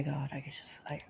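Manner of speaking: trailing off in a stunned whisper. Real, not acted. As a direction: breathe it out, lost for words. Stunned reaction to message.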